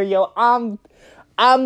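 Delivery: plain reading voice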